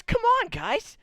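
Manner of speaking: high, bright call to the group; rally the party with a high eager shout; eager guide call. Acted. Guide waving the players over, high eager call. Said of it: "he goes".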